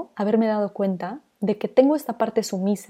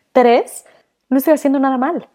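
indignant emphasis; 5 semitones higher, 7 dB louder than usual. Real, not acted. Counting reasons, indignant.

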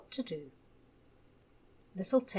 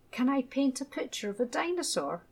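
eager little voice; 6 semitones higher, 4 dB louder than usual. A narrator's reading. Child suddenly eager.

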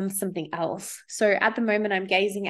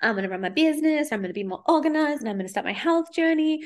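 eager resolutions; 7 semitones higher, faster than usual. Retold or imitated. People's new-year style resolutions.

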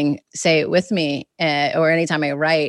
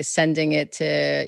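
ordinary delivery

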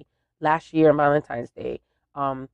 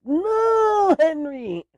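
mock protest; 13 semitones higher, much slower than usual. Quote inside family story.

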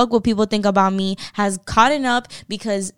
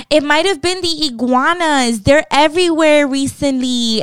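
higher, lilting neighbor voice; lightly voiced chatty neighbor, pitched up; imitated neighbor; 6 semitones higher, 3 dB louder than usual. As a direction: put on a neighbor offering a theory.

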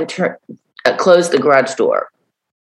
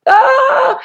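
excited; 4 dB louder than usual. Retold or imitated. Calling Jennifer in a panic.

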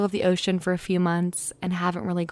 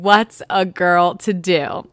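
quoting Jill; 5 dB louder, slower than usual. Retold or imitated. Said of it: "so that's what Jill said".

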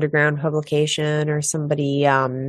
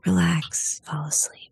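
sleepy whisper. Pretend. Mock sleepy podcast intro.

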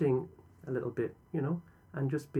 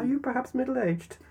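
teasing; 13 semitones higher, 5 dB louder than usual. Real, not acted. Teasing question to co-host.

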